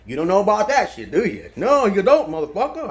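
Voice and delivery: loud, mocking challenge; taunting. Real, not acted. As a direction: call them out loud. Taunting listeners about condoms.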